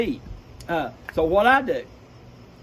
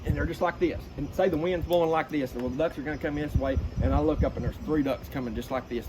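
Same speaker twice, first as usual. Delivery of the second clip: ordinary delivery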